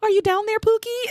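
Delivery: high, sing-song call into the bottle. Retold or imitated. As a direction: call down sweetly as if someone's inside. Quoting character calling into bottle.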